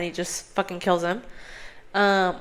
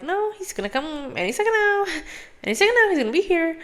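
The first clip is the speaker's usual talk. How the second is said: much higher, breathless lovesick princess; high giddy princess voice, fast at the end; mock lovesick princess; 10 semitones higher, faster than usual. Voicing the hopeful princess.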